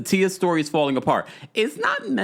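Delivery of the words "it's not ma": sudden very high squeak; high-pitched "it's not"; squeaky protest. Real, not acted. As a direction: squeak it out in disbelief.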